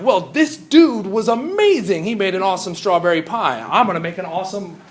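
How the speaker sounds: high, loud gushing admiration; imitated eager student. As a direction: play a starry-eyed beginner full of big plans. Voicing eager new science students.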